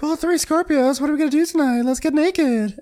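very high put-on party-girl voice; squealing impression. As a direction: pitch it way up, caricature style. She voices Brandy at the table.

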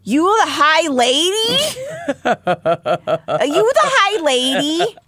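high, goofy cartoon voice with a laugh; squeaky comic voice breaking into laughter; Jerry Lewis voice. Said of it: "I think that's Jerry Lewis".

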